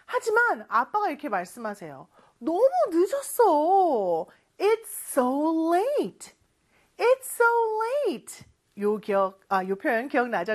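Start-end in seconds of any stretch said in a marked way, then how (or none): 2.4-6.3 dad's bedtime line. Acted. Dad telling child bedtime. 7.0-8.4 loud repeat. Shown as a demonstration. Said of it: "다시 한번 크게 해볼까요".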